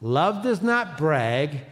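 slow, deliberate reading of scripture aloud; measured group recitation. Shown as a demonstration. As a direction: read it out slowly with the congregation. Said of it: "let's read this out loud".

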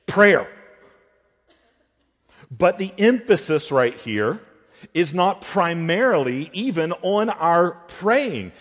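ordinary talk

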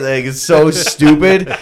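usual speaking voice

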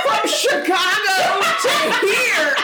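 high, slow, laughing mock horror; laughing mock alarm. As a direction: squeal it while laughing.

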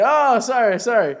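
high, loud fake sorry; mock apology. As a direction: brush it off with a sarcastic sorry. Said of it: "And Jay's like".

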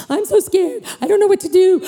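high-pitched mock panic; whiny, worked-up "help me" call; scared. Acting out frightened call for comfort.

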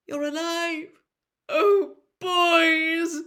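high, loud cry of relief; overjoyed mother. A narrator's reading. Mother to her boys, a loud high cry of relief. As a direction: burst out with joy at seeing them.

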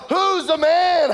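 high, loud boast; gloating yell. Retold or imitated. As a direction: crow it with all you've got. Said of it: "You know".

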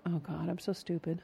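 soft, muttered self-reproach; hushed, discouraged aside; self-talk. Acted. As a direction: say it under your breath to yourself. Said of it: "Do you ever say to yourself".

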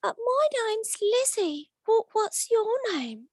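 high, slow put-on woman's voice; playing Lizzie. Mate playing Lizzie answers nervous Stephen in a high, slow woman's voice. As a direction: pitch it way up and drawl it out like a flirty woman at the bar. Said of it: "I'll, I'll be her".

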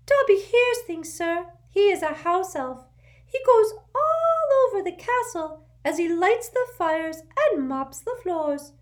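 urgent little servant squeaking; an anxious house elf waking his master; squeaky house elf. A narrator's reading. Dobby voiced.

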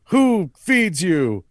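high, slow, drawn-out rallying question; rallying villain. Retold or imitated. Villain in monologue to his crowd, rallying call. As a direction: stretch each word to work up a crowd.

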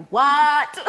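overwhelmed by the offer, laughing; stunned disbelief. Retold or imitated. Hearing she booked Elphaba, shocked laughing cry. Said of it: "And I was like".

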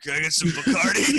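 high, loud, pitiful drink order; hammed-up battered customer at the bar; wounded drunk. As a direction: plead for a drink while hurt.

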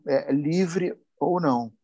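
usual speaking voice

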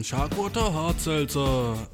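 slow, drawn-out commercial voice; mock advertising read; ad slogan voice. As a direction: read it like a slick ad jingle.

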